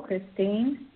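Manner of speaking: normal talking voice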